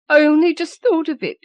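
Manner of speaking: very high, loud hysterical rush; near-frantic, racing with the idea; grieving mother seized by a wild hope. A narrator's reading. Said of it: "she said hysterically".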